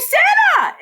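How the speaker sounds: loud, high cry of joy; excited shout. Acted. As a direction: burst out with delight. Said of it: "The boy exclaimed".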